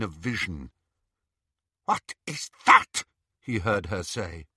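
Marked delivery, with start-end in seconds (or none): 1.8-3.0 high, louder witch voice; Bellatrix demanding. Acted. Bellatrix spotting the sword, sharp demand. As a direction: snap the question like a cold witch.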